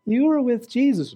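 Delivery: lightly voiced girl accusing Peter. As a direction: point the finger like a bold young girl. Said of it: "just a little Girl Scout".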